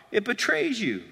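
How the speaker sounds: usual speaking voice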